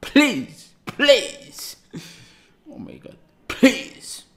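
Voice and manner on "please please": ordinary talk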